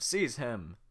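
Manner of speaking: head guard ordering an arrest; guard command. Acted. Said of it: "head guard".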